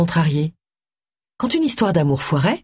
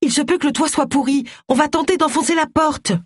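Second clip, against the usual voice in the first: high-pitched raised voice; calling out; 11 semitones higher than usual. A narrator's reading. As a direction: shout instructions up to someone. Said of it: "cria Tony". (crié vers le haut, voix aiguë)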